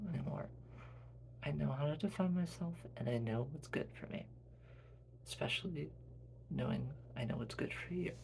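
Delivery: very soft, hushed voice; gently insist, keeping it very quiet; quiet, tender protest; Quinn, loving but independent. Acted.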